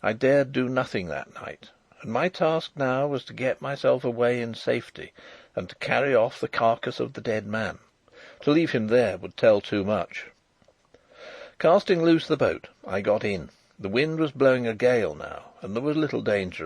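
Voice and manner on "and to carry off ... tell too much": ordinary delivery, nothing marked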